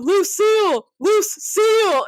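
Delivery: high, loud mock alarm call; yelled warning. Retelling the seal warning to a friend, mock yell. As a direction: call out an alarm at full pitch.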